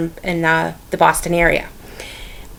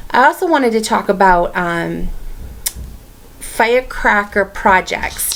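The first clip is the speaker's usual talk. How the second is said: normal talking voice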